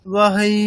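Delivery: বাহাই with a tiny break before the last ই; বাহাই said with a slight stop and a jolt on the last vowel; pause before final i. Shown as a demonstration. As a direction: say বাহাই, then a small push on ই. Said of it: "আমরা একটা pause নেব".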